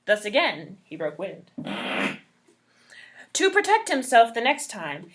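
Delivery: ordinary delivery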